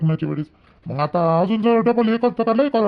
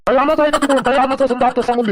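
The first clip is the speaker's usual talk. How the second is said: high, raised-voice question; irritated yelling; 4 semitones higher, 3 dB louder, faster than usual. Acted. Husband asking if anything must be brought, yelling irritably. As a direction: call it out crossly before leaving. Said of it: "ओरडून गेले".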